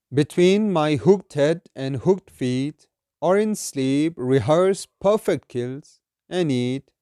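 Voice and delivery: slow, measured verse reading; solemn, deliberate poetry reading; poem recitation. Acted. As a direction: recite it slowly, drawing out each phrase.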